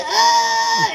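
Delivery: high, loud fake wail; mock child's cry. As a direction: bawl like a child faking tears. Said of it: "then the kid starts crying".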